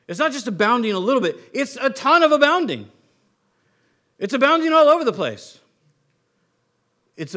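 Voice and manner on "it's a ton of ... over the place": loud, high, emphatic burst; big, raised-pitch pulpit enthusiasm; exuberant preaching. Real, not acted. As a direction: proclaim it with rising excitement. Preacher builds on abounding grace.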